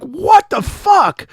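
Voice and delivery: slow, high, loud disbelieving yell; shocked shout. Retold or imitated. Friend opening Facebook to find his account suspended, stunned shout.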